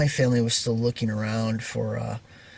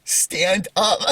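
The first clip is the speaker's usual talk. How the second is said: higher-pitched retold line cracking into laughter; amused punchline; 8 semitones higher than usual. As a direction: repeat the punchline while starting to giggle. Punchline repeated, breaking into laughter.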